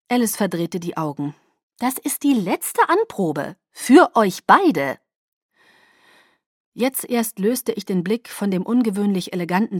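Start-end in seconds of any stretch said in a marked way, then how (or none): 1.8-5.0 slow, high, emphatic explanation; drawn-out, pointed emphasis; exasperated insistence. Acted. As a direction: spell it out with strained patience. Alice insists with eye-roll.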